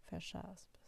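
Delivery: soft, dropped closing cue; dictating. Acted. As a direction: let it fall away quietly. Dictating quote marks.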